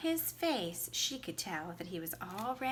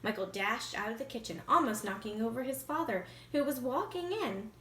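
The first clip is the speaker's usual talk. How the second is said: ordinary delivery, nothing marked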